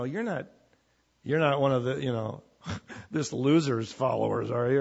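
lightly voiced snide sneer at Peter; a mild mocking jab with a scoffing laugh. Servant girl mocking Peter at the door, mildly snide. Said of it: "kind of a snide".

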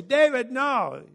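mild mock urgency pressing David to act; hammed-up protest; urgent plea. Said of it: "We want to say".

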